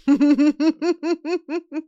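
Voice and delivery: high, hearty villain laugh; diabolical laugh. Shown as a demonstration. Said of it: "That's like your diabolical laugh".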